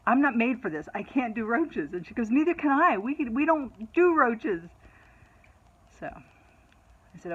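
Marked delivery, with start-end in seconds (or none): 0.1-1.9 higher, louder mock protest; retold exasperated protest. Mother refusing her daughter's roach job, acted exasperated protest. 2.3-4.6 high, loud imitation of a frantic daughter; retold frantic protest. Daughter protesting to mother about roaches, mock-frantic and high.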